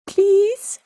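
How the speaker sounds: high drawn-out begging; playful pleading. Pretend. Co-host begging an actor to join the podcast, high playful plea.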